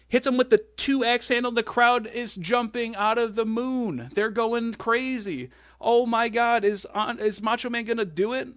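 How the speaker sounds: high, loud commentary build; excited. Real, not acted. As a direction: call it like the crowd is erupting. Excited retelling of match climax.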